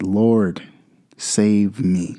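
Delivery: ordinary talk, nothing marked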